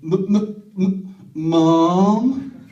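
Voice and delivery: halting stammer building to a loud Mom; stuttering child. Retold or imitated. Imitating the son's first words, stuttering. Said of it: "His son's first words were".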